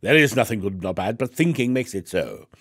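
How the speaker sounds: hammed-up English stage accent. Shown as a demonstration. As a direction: recite Shakespeare with a put-on English accent. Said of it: "I should say that with an English accent".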